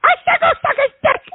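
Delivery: high, fast furious outburst; furious yell. Real, not acted. Host repeating himself to a mocking caller, furious yell. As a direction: blurt it out at the top of the voice.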